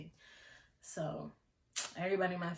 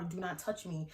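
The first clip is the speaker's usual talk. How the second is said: ordinary talk, nothing marked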